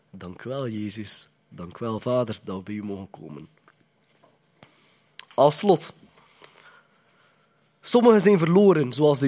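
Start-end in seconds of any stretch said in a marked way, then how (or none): 0.1-3.5 softer, humble thanks; quiet prayer. Pretend. Believer thanking Jesus and the Father, soft and humble. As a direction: murmur a grateful prayer.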